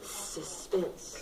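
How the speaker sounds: whispered, low and breathy. Shown as a demonstration. Teacher whispers suspense to the class.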